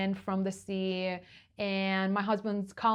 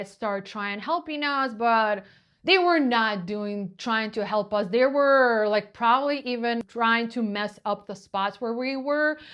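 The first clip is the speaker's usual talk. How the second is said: ordinary delivery, nothing marked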